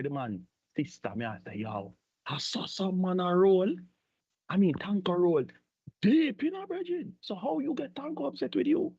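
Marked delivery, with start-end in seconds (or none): 1.6-8.9 high squeaky disbelieving outburst. Acted.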